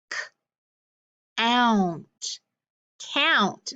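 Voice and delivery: slow blended segments then whole word; sounding it out. Shown as a demonstration. Teacher sounding out a word for the class. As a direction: stretch the sounds apart before blending. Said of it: "if I put a C in front of it".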